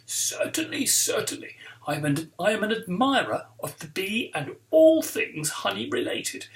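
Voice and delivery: jovial posh TV-presenter voice; plummy, theatrical teacher's welcome; posh supply teacher. Acted. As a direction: greet the class like someone posh off the telly. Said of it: "He sounds like somebody posh off the telly".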